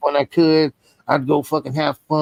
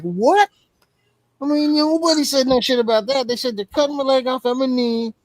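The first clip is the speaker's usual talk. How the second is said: high, fast, indignant outburst; a pitched-up protest that gets faster; outraged disbelief; 8 semitones higher than usual. Retold or imitated. To the surgeon about cutting everything off, high outraged protest.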